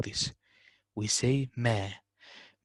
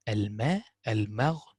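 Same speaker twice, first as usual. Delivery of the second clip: ordinary talk, nothing marked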